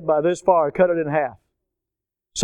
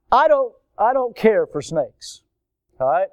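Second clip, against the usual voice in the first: ordinary delivery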